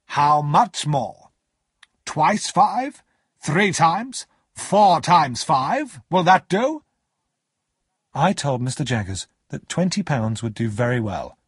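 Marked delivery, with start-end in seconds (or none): 0.1-6.8 higher, deliberate, mounting questions; imposing cross-examiner's voice; stern lawyer questioning. Acted. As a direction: press with slow, emphatic questions.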